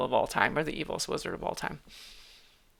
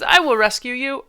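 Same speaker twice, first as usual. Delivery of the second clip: heroic declaration; 7 semitones higher, 7 dB louder than usual. Acted. Hero voicing his line.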